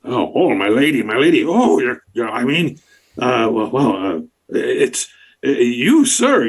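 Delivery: stammering, fumbling frog-man; flustered bullywug. Acted. Bullywug fumbling to address the party, flustered. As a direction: trip over every word in a fluster.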